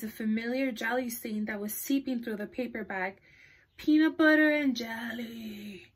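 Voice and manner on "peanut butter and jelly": slow zombie moan. Acted. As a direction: drag it out like a hungry zombie. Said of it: "he moaned".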